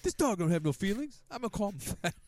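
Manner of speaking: very high put-on comic voice; hammed-up, high-pitched heckling impression; imitated yelling. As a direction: mimic a sitcom character loudly mocking a dog. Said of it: "yelling at the dog".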